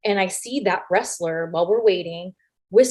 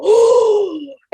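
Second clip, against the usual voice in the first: loud, high mock-shocked gasp; imitated crowd gasp; 12 semitones higher, 7 dB louder than usual. Crowd reacts like high school.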